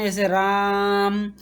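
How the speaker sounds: "राम" with the vowel stretched long and called out; long called-out Ram. Shown as a demonstration. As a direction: call "राम" as if shouting across a field, holding the आ. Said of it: "राम ही हम बोलते हैं तो चिल्लाते हैं". ("राम" को खींचकर पुकारते हुए)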